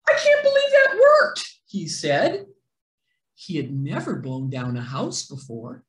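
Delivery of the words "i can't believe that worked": very high, loud astonishment; wolf shocked his huffing worked; amazed. A narrator's reading. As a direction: squeal it in disbelief.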